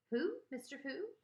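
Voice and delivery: high, sharp-rising surprise; taken aback; startled question. A narrator's reading. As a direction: question it in disbelief, pitch jumping. Mother startled by unknown name.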